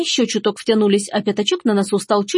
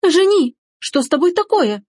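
alarmed shriek; 9 semitones higher than usual. Acted. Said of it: "взвизгнула Софи". (испуганный визг)

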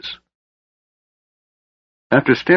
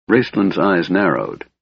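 usual speaking voice

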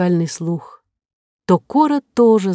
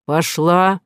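ordinary delivery, nothing marked

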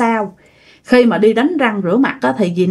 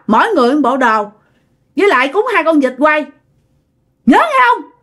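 high-pitched, insistent ordering; possessed woman's demands; 5 semitones higher than usual. Retold or imitated. Spirit in the neighbour demands offerings from the widow, high and insistent. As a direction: act out a spirit laying down demands. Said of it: "Thì lúc đó mới quay qua, nói".

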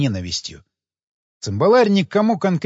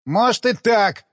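snapping back; 5 semitones higher, slower than usual. A narrator's reading. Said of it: "огрызнулся".

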